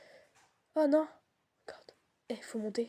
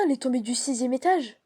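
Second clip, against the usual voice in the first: usual speaking voice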